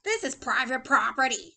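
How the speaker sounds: loud, grumpy warning; cross. A narrator's reading. Hank warns a tortoise off his property, crossly.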